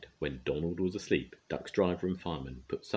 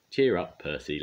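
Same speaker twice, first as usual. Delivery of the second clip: ordinary delivery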